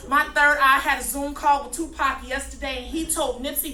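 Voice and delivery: loud, driving spoken-word rant; hammed-up slam-poet intensity; fired-up slam poetry. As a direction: preach the nonsense at full force. Shouts the parody poem.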